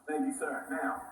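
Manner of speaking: usual speaking voice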